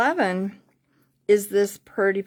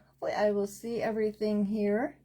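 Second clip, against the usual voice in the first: ordinary talk, nothing marked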